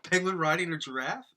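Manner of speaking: real laughter, asking in disbelief; giggling incredulous question; laughing disbelief. Real, not acted. Laughing at an absurd request.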